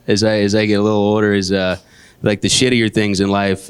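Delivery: ordinary talk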